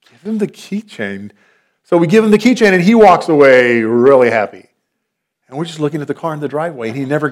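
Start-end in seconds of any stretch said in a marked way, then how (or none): 0.1-1.3 weary wife. Retold or imitated. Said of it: "she's like".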